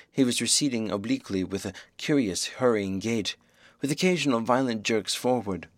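ordinary delivery

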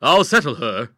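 put-on loud, hearty young man's reply; masculine voice. A narrator's reading. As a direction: answer loudly as the man of the house. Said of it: "answered a masculine voice".

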